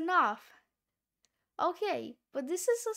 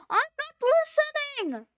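shout; 9 semitones higher, 6 dB louder, faster than usual. Real, not acted. Refuses to hear the heroine die.

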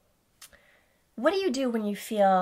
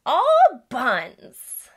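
silly phrase; 6 semitones higher, 9 dB louder than usual. Shown as a demonstration. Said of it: "I like to say".

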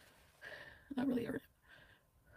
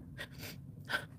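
half laugh half sob; 10 dB quieter than usual. Real, not acted. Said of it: "I don't know if I should laugh or cry".

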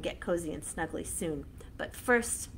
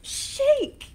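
shout; 7 dB louder than usual. Shown as a demonstration. Pumpkin chant, shake shouted.

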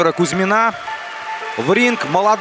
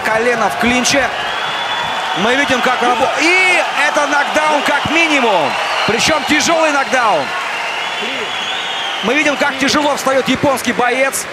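excited commentary; 4 semitones higher than usual. Real, not acted. Commentator reacts to knockdown.

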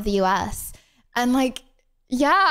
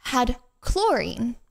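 slow reveal with long pauses, last word pitched up; dramatic reveal; much slower than usual. Real, not acted. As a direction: spell out the horror word by word. Dramatic reveal of shocking ingredients.

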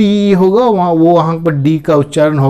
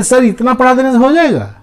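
quick, higher mock student voice; student's question; 8 semitones higher, much faster than usual. Teacher mimics a doubtful student, quick and higher. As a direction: play a doubtful student asking fast. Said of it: "बोले".

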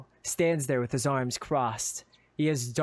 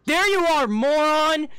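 high, very loud scolding yell; angry shout; 13 semitones higher, 9 dB louder, slower than usual. Acted. Friend finally finding you, exasperated shout. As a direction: yell it across at your idiot friend.

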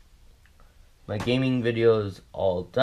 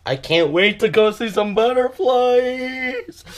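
excited high voice; 12 semitones higher, 3 dB louder than usual. Acted. Excited kid voice, high and slow.

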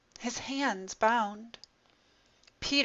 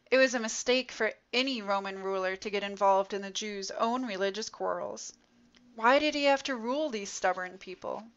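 plain reading voice

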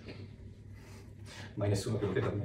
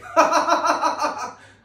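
loud high laugh; high burst of laughter; 12 dB louder than usual. Acted. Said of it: "Il dottore". (risata acuta e forte)

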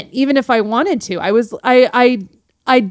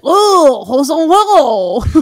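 high, loud bogus French; pretend French accent; 3 semitones higher, 4 dB louder than usual. Shown as a demonstration. Speaker recalls pretending at accents, fake French. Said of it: "pretend I did French accents".

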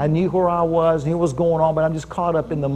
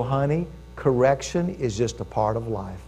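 gentle father; 5 semitones lower, 6 dB quieter than usual. Retold or imitated. Father gently teaching his daughter.

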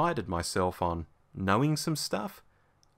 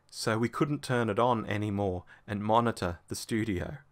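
ordinary talk

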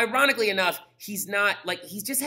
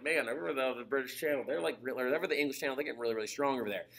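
low, soft, hurried grumbling; mock worried muttering; 5 semitones lower, 8 dB quieter, faster than usual. Teacher imitating the British fretting about Germany, hushed mock worry. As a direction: mutter it quickly, as if among yourselves.

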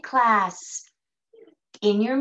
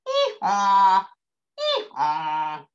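donkey bray; 4 semitones lower, 4 dB louder, much slower than usual. Shown as a demonstration. Teacher showing children the donkey, donkey bray. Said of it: "Can you make that sound?".

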